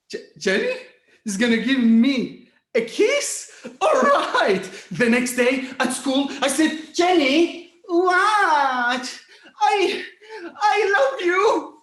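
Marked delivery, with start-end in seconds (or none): 0.1-4.6 can't believe his luck; excited disbelief. Retold or imitated. Teen to mom, giddy laughing excitement. 6.9-11.7 loud, high nervous outburst; blurted confession. Retold or imitated. Teen to Jenny, loud nervous blurt.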